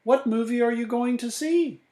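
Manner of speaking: high-pitched eager little voice; high childlike question; child character's voice. A narrator's reading. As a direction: ask it high, like an excited child. Child character asks in story.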